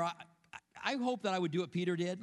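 normal talking voice

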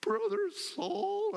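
Brother Saul sung out high and slow; high drawn-out Brother Saul. Shown as a demonstration. Preacher imagines Ananias greeting Saul, high and drawn out. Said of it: "I don't know if he went".

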